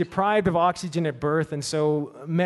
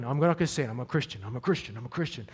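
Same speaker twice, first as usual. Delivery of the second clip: lower, much softer, repeating to himself; quiet muttering; 5 semitones lower, 6 dB quieter, faster than usual. Retold or imitated. Tim sitting down, repeating it quietly to himself. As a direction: settle back and repeat it under your breath. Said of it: "And he sits right down".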